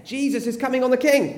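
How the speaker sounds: high, cheering cry; excited announcement. Shown as a demonstration. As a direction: call it out to rouse the children. Practising the most excited level.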